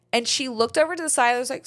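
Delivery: usual speaking voice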